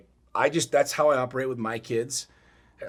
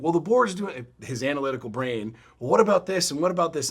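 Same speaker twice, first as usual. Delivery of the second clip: higher, louder objecting student; retold frustration; 5 semitones higher, 4 dB louder than usual. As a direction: mimic a frustrated learner's protests. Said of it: "There was frustration".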